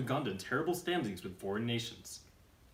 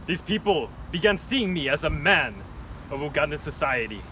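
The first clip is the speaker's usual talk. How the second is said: dictator voice; 2 semitones higher, 8 dB louder than usual. Acted. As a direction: brood like a masked tyrant. Dictator reflecting.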